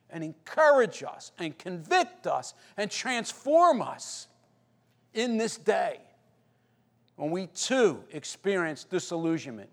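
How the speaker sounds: usual speaking voice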